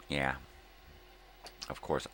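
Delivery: ordinary delivery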